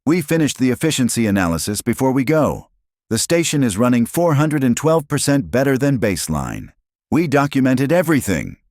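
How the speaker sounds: put-on high-pitched child researcher voice; eager young cub. A narrator's reading. Cub reporting research results to Mama, high and eager. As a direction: report proudly in a high little cub's voice.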